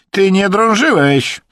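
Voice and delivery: loud, high, drawn-out cut-in; brazen, taunting interruption; insolent. A narrator's reading. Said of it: "bezczelnie przerwał mu Rosjanin".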